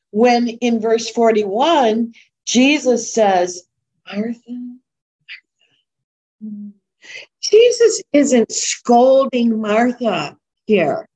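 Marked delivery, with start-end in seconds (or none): 4.0-6.7 much softer, gentle calling of her name; gentle, intimate. Retold or imitated. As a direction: say her name tenderly, almost under the breath. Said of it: "He's speaking gently to her".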